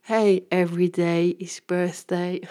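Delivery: whispered, light and teasing; playful whisper. Retold or imitated. Whispering to stunned guests that every day is a birthday. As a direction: whisper it like a cheeky secret. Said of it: "I said".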